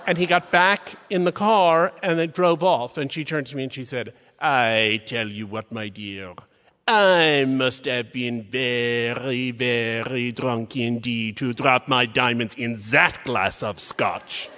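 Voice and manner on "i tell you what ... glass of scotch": put-on very slow, drawn-out French accent, lower in places. Curator confiding to a colleague once the man drove off, hammed-up French accent. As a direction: savour each word in a hammed-up French accent. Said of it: "in her amazing French accent".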